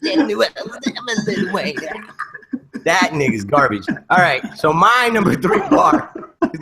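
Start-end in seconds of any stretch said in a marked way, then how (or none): 0.0-1.8 high, laughing rapper impression. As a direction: squeal the line through laughter. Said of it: "he just goes like".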